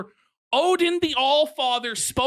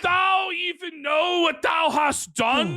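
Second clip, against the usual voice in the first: Odin voice; 5 semitones higher than usual. Retold or imitated. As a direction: boom it like an angry Norse god. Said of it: "Odin said".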